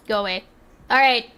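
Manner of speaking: ordinary delivery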